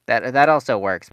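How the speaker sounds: ordinary talk, nothing marked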